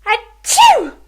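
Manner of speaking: high, loud fake sneeze. Fairy sneezing behind the chair. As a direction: burst out a big achoo.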